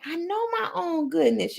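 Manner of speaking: high-pitched, lilting repeat; playfully high self-praise; playful affirmation. Shown as a demonstration. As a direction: lift it up high and savour it.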